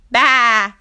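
loud bleating baa; a loud sheep baa. A narrator's reading. Sheep speaks.

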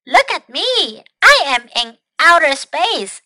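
high, loud storybook shout; excited shout. Acted. Snail shouting from outer space, excited shout. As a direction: call it out proudly to everyone below. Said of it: "Snail shouted".

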